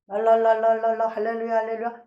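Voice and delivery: rapid monotone tongue-rolling babble; imitating tongues. Believer faking tongues to get started, monotone babbling. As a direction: fake speaking in tongues on one note. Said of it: "흉내 좀 내볼까?".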